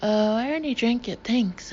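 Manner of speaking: ordinary delivery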